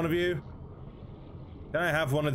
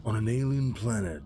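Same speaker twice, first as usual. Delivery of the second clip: usual speaking voice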